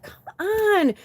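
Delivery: exasperated whine at the mice. Retold or imitated. To herself on seeing the mice, high exasperated whine.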